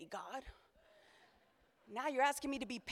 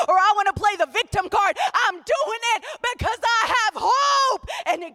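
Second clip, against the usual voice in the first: loud, high preaching, climbing to a shout; preaching at full force; 10 semitones higher, 14 dB louder than usual. Real, not acted. Pastor shouting hope to the congregation, full force. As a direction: preach it out with everything.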